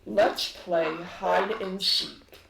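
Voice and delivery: slow, loud, mocking villain voice; taunting villain. Azalin taunts the party, slow and loud. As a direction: taunt them like a game. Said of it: "Azalin laughs and says".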